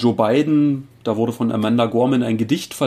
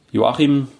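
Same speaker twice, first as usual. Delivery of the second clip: ordinary talk, nothing marked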